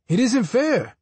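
put-on child voice. Child complaining to mother.

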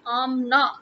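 "Amna" as AHM-nah, open ah vowels; two clean syllables in "Amna", ahm-nah; Pakistani Amna. Shown as a demonstration. Showing how Pakistanis say the name, AHM-nah. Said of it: "In Pakistan, we say".

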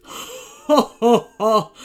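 loud booming monster laugh; mocking evil laugh; villain laugh. A narrator's reading. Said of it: "said the snow monster".

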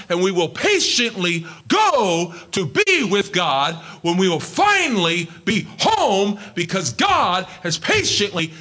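high, loud, slow preaching cry; full-force preaching. Acted. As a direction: preach it out to the back row.

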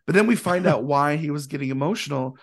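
ordinary talk

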